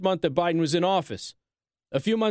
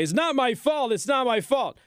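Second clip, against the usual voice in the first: higher, whiny mock of Biden; mocking whine; 6 semitones higher than usual. Host mocks the president blaming others, whiny. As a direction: make the excuse sound childish.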